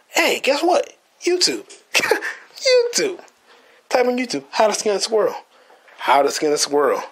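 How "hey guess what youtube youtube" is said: high laughing gotcha; laughing triumph. Real, not acted. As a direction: crow it out with a laugh.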